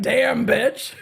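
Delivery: voicing Corey's thought through a laugh; tossed-off quip mid-laugh; laughing mock quip. Streamer voicing the defendant's reaction, laughing. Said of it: "Even Corey's like".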